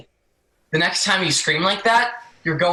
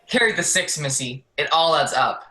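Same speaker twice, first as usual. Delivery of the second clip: ordinary talk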